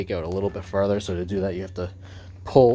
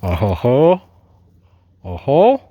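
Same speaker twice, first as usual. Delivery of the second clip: talking with phone in mouth; muffled, mouth clamped on phone; phone held in teeth; 2 semitones lower, 6 dB louder, slower than usual. Real, not acted. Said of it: "I'm gonna have to hold the phone in my mouth".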